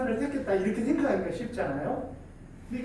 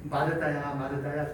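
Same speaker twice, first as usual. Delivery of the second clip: calling a name gently and lower; tender call; 6 semitones lower than usual. Shown as a demonstration. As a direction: say her name with pity and love. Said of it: "이렇게 읽지 않고".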